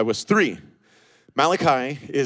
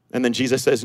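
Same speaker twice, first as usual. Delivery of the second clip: ordinary delivery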